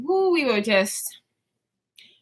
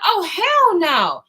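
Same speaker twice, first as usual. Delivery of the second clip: retold yell; 5 semitones higher, slower than usual. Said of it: "yelling, and she's like".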